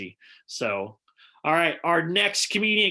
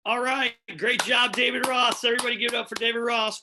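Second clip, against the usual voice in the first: usual speaking voice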